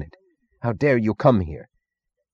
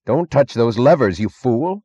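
higher, louder urgent order; alarmed warning; 5 semitones higher, 5 dB louder than usual. A narrator's reading. Doctor Stadler to drunk Meggs at the levers, alarmed. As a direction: warn sharply, voice raised.

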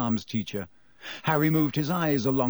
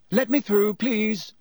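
a pompous prefect, pitched higher, pushing through; head boy voice, higher and a bit louder; self-important; 8 semitones higher, 3 dB louder than usual. A narrator's reading. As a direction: clear the way like you own the place. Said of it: "bustling importantly".

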